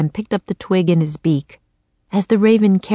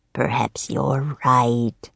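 low, slow villain; sly Raven King; 4 semitones lower, much slower than usual. A narrator's reading. Raven King pretends to agree with the boy, low and slow.

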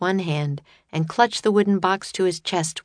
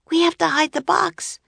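high-pitched, urgent voice; urgent alarm; 12 semitones higher than usual. Acted. Annie hears bandits coming, urgent alarm. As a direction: urge it high and anxious.

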